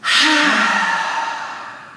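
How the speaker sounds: loud, breath-only open-mouth exhale; big releasing sigh. Shown as a demonstration. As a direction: dump all the breath out audibly.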